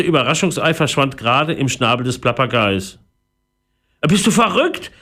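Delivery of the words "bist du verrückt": high-pitched, outraged question; beside himself. A narrator's reading. Philipp finds treasure chest empty, outraged cry. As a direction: burst out in disbelief and anger. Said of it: "Philipp war außer sich".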